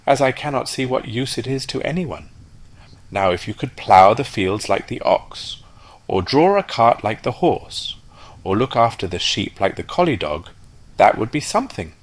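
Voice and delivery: a sensible farmyard matron; practical duck. Acted. Duck lectures on usefulness.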